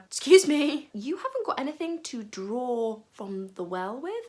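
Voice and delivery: loud excuse me, then slow and doubtful; sceptical woman. Acted. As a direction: challenge him, drawing it out. Woman questions Jesus sceptically.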